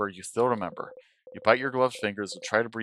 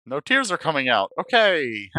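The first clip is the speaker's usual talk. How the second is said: frustrated; 6 semitones higher, 6 dB louder, slower than usual. Acted. Frustrated that tears won't come.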